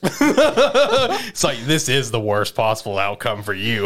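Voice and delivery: laughing through a gloating line. Retold or imitated. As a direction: gloat while cracking up. Gleeful villain reveal, laughing.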